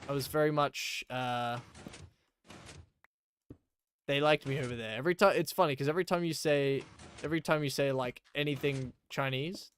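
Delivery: normal talking voice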